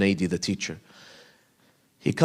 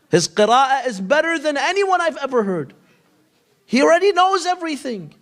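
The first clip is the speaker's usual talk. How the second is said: put-on higher, lit-up praise of the boy; awed raving; 7 semitones higher than usual. Teacher marvelling to his friend about the boy, awed. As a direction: marvel at the child's knowledge.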